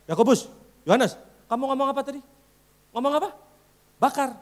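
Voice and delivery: loud high mock rebuke; hammed-up scolding Jesus. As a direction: play Jesus angrily questioning disciples. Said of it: "lalu Yesus berpaling dan gini".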